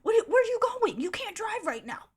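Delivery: high pleading yell through the car window; imitating him pressed against the car window; mock frantic plea. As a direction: beg her not to drive, high and loud. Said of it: "And he's like".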